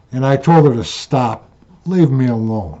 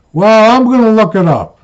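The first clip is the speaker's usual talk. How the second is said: huffy retort; 7 semitones higher, 4 dB louder than usual. Retold or imitated. Wife huffy reply.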